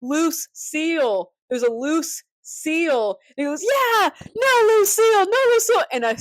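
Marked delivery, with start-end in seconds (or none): 0.0-3.2 high, drawn-out mock warning call; retold yell. Someone warns the swimmer of a seal, mock yell. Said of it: "someone yells". 3.6-5.8 very high, loud acted shout; defiant yelling. Swimmer shouts back at the warning, mock defiant yell.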